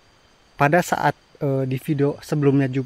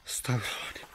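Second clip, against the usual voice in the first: frightened breathy whisper; scared whisper; 18 dB quieter, faster than usual. Real, not acted. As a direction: whisper prayers in dread. Whispered prayers in fear.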